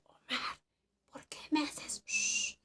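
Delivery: hushed whispered question; breathy whisper to Omar in the dark hut. Retold or imitated. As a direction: whisper so nobody outside hears. Asking Omar quietly in the dark.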